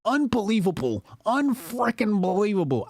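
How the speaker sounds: high-pitched mock-incredulous echo; mocking imitation. Streamer parroting a Trump-ad politician's line, mockingly. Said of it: "nobody that talks like that".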